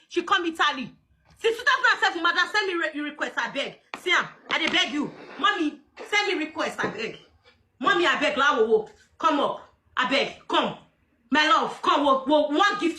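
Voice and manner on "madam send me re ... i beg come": ordinary talk, nothing marked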